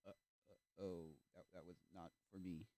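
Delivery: low, hushed, halting stammer; awkward embarrassment. Acted. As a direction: trail off quietly, mortified.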